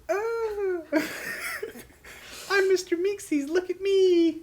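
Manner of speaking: high, loud cartoon voice with laughter; Mr. Meeseeks voice. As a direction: squeal it out like a cartoon helper. Imitating Mr. Meeseeks character.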